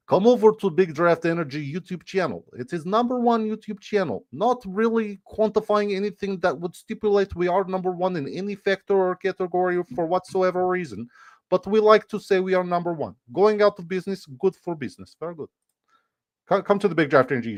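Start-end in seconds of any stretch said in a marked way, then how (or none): 0.1-2.4 high mock-Russian plug; Russian accent. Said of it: "super good Russian accent". 2.6-15.5 high-pitched hammed-up Russian accent. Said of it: "super good Russian accent".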